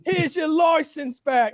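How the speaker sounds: very high, very slow, hammed-up yelling with laughter; mock gloating shout. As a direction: crow over the beaten speeder. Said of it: "the British cop".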